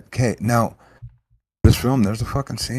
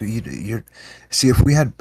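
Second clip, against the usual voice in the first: usual speaking voice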